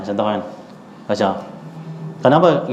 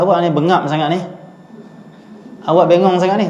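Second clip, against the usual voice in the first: mock insult; 6 semitones higher than usual. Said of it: "Imam Syafi'i kata".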